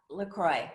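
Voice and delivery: LACROIX said slowly as la-CROY; slow "LaCroix", rhyming with boy; correct pronunciation. Shown as a demonstration. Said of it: "The correct answer is LaCroix".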